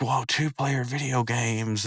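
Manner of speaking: hushed, drawn-out wonder; slow awed "whoa" at half voice; awestruck. Retold or imitated. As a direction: mimic slow, soft amazement. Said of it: "like, all struck".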